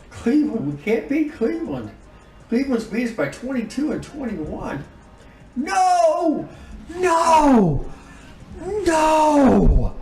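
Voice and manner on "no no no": repeated high, loud cries of no; anguished no. Real, not acted. Gamer yelling at his injured star's news, anguished loud cries. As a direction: howl it out in disbelief at the screen. Star player's injury shown on screen.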